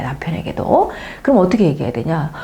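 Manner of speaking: usual speaking voice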